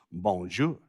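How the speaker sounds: low, soft French greeting; better French accent. Shown as a demonstration. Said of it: "say it with a better accent".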